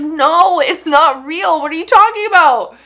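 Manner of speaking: loud, high mock exasperation; hammed-up loud, high-pitched protest; exasperated denial. Acts out people's emphatic warning.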